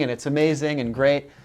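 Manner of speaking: ordinary talk, nothing marked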